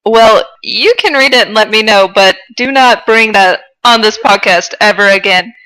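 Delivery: ordinary delivery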